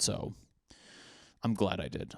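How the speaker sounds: ordinary talk, nothing marked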